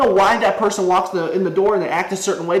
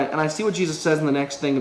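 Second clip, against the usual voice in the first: ordinary delivery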